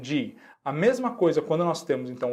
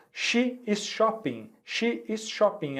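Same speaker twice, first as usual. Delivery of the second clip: She is shopping, higher and slow with a falling end; slow model sentence; 6 semitones higher, slower than usual. Shown as a demonstration. Teacher reading the first example to students, high and slow. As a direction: stretch it out for learners to repeat. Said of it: "Vamos ver aqui o nosso primeiro exemplo".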